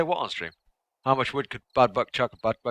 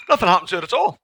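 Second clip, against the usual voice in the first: usual speaking voice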